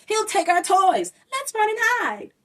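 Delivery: put-on high frightened children; shrill scared kids' voices; cried. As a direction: warn the others in a panic. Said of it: "they cried".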